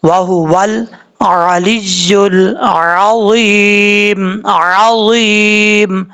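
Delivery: very slow chant with a throaty ع in अलियुल and a full ज़ in अज़ीम; slow recitation; tajweed demo. Shown as a demonstration. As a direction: draw out each word. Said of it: "आइन को अपने मखरज से अदा करना है".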